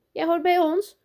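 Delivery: high, loud call across the yard. A narrator's reading. Teacher tells Lucas he belongs, calling out. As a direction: call him over to join in. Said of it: "roept juf Evie".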